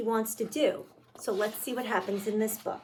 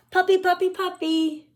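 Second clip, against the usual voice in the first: high, loud eager chant; excited begging; 7 semitones higher, 6 dB louder than usual. Acted. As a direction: bounce on each puppy. Pigeon begging for a puppy.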